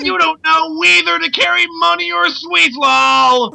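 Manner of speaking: high, slow, ranting cry; over-the-top shouting. Pretend. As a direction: bellow it like a street preacher. Reading a profile at full force.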